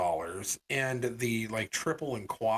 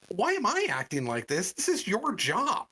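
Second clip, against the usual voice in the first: higher-pitched, affronted retort; indignant comeback; 7 semitones higher than usual. Retold or imitated. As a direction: throw the question back, rising into exasperation. Customer throws the question back.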